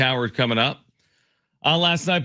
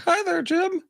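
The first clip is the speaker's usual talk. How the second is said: very high puppet voice, lips barely moving; ventriloquist voice. Pretend. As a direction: talk like the dummy without moving your mouth. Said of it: "Are you trying to talk without moving your mouth?".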